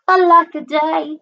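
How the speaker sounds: high, held-note lament; crying out. A narrator's reading. Drip laments being left behind, crying out. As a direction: lament it high, like a storybook wail. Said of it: "And he cried".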